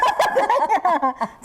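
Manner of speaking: bright burst of laughter; high laugh. Real, not acted. Laughing at husband's joke about carrying her, a real laugh. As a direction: laugh openly, pitched high.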